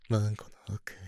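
ordinary delivery

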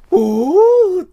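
high, loud drawn-out oh; a delighted leering ooh; leering exclamation. Acted. Wolf man sees her bared body, loud excited ooh.

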